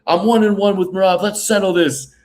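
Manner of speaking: high, projected challenge; mock fighter challenge. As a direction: throw down a rematch challenge. Acts out suggested fighter promo.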